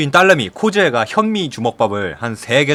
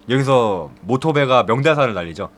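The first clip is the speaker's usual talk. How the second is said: normal talking voice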